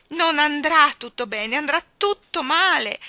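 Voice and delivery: put-on loud sobbing child; a little girl sobbing. A narrator's reading. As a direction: sob it into the pillow. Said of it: "singhiozzò Barbara".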